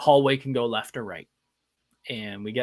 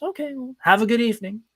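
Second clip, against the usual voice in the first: awkward cheerful goodbye; 11 semitones higher, faster than usual. Retold or imitated. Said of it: "He goes".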